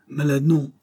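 trying to sound breezy; feigned cheerfulness. A narrator's reading. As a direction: brush off concern with put-on cheer. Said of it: "en affectant de parler gaiement".

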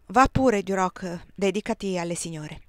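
usual speaking voice

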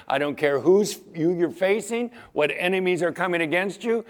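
ordinary talk

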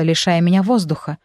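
plain reading voice

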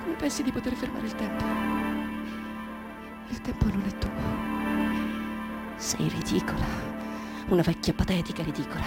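very soft, low, slowing murmur; hushed self-contempt. Acted. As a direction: sink into quiet, bitter scorn. Same monologue, sinking into self-contempt. (disprezzo di sé, sommesso e grave)

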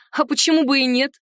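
ordinary delivery

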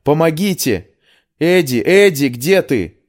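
high, loud cry for help. A narrator's reading. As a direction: shout desperately for a missing friend. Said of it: "крикнула я".

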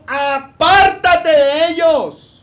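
loud, drawn-out commanding shout; booming, urgent admonition from a wise man; sage's warning. Acted. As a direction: cry out a warning, stretching it high and slow. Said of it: "qué le dice el sabio al muchacho".

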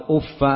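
أف with doubled f and a bare "a" ending; fatha without tanween. Shown as a demonstration. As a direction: pronounce أف as "uffa". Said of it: "وضمها وكسرها بتنوين وبغير تنوين".

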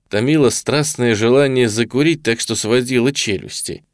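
ordinary delivery

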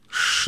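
sharp hushing shh; shushing hiss. Acted. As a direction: hiss them quiet. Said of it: "зашипел я".

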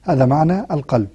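usual speaking voice

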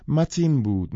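ordinary delivery, nothing marked

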